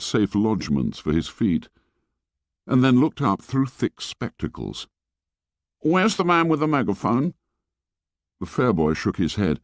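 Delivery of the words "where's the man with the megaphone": high-pitched schoolboy question; fat boy. Acted. Fat boy asking the fair boy, high questioning voice. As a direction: ask in a high boy's voice.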